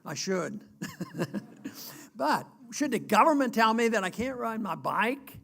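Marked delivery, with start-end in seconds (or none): 0.7-2.1 short laugh. Real, not acted. Laughs at own answer.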